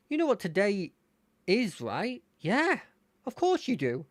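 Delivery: swooping pitch, chatty and nice; Terry. Acted. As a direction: play an excited, nice friend. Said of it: "gonna give him a nice-ish voice".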